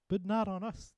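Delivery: very high, loud panicked oyster cry. Acted. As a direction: shriek it as frightened oysters. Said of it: "the oysters cried".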